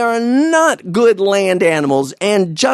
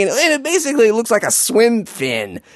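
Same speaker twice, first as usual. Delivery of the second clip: ordinary talk, nothing marked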